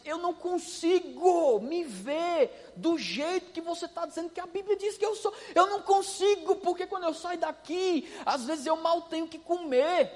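a touch of a woman's tearful voice; tearful plea. Retold or imitated. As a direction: act out her crying through the words. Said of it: "Ela começou a chorar e disse".

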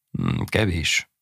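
slow, understated grim verdict. A narrator's reading. Priest weighing the purse finds it wanting, mildly grim. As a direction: weigh it slowly, a touch grimly. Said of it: "mondta komoran".

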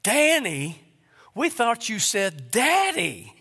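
put-on kids' outraged, high-pitched protest; pure rebellion. Kids rebelling at mom over the hamster, high indignant protest. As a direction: protest high and slow, in disbelief. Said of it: "with just pure rebellion".

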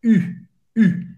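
"U" as an I with lips rounded like O; rounded French U. Shown as a demonstration. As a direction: say "U" as a tight, rounded I. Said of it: "Faz a boca do O e fala I". ("U" com boca de O e som de I)

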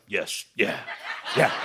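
very low mock-emotional murmur; softly agree, as if moved; imitated choked-up agreement. John moved by the boss's gift, low mock-emotional. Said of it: "P J's getting emotional. John's like".

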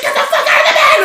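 imitated piercing, furious screech; screaming at him. Girlfriend orders Mark out of the bed, screaming. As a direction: mimic a girlfriend shrieking at full pitch. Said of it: "she's, like, screaming at him".

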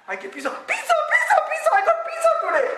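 high, hammed-up pizza celebration; mock overjoyed child. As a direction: imitate a kid jumping for joy, half laughing. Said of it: "don't jump with so much joy".

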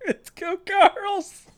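high, slow, laughing repeat; incredulous drawn-out echo; amused disbelief. Real, not acted. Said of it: "I didn't hear it three times".

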